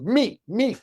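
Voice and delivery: high-pitched eager volunteer. Retold or imitated. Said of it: "She's eager".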